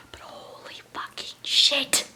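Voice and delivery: slow, breathy shocked whisper; stunned whisper. Real, not acted. Stunned by the chapter's twist, whispering it out slowly. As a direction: breathe it out in disbelief.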